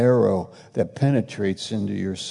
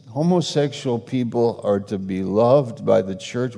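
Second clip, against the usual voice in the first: ordinary delivery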